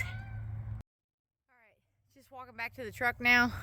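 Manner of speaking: breathless, panting between words; winded from the walk back; out of breath. Real, not acted. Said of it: "Sorry, I'm out of breath".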